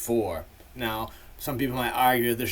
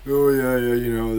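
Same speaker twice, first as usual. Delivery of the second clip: louder, put-on dismissive voice; dismissive mocking quote; 7 dB louder than usual. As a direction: imitate a scoffing critic. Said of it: "some people might shit on Cypress Hill and be like".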